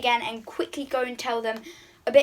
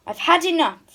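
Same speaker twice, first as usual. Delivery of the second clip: louder, higher angry snap; snapping; 7 semitones higher, 8 dB louder than usual. Acted. Poppy loses patience with the frog. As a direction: bite it off in fury. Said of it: "snapped Princess Poppy".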